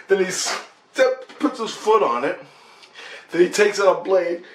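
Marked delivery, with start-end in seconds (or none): none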